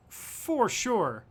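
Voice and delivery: playful high-pitched "For sure"; mock high voice. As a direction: echo yourself in a silly high voice. Repeats "for sure" in new voice.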